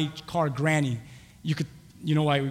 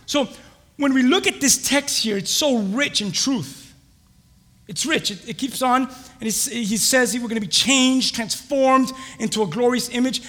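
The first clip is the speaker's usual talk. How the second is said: raised, high, emphatic preacher delivery; impassioned preaching; 8 semitones higher, 6 dB louder than usual. Real, not acted. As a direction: preach it out with force. Preaches on the text.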